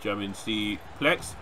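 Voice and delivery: normal talking voice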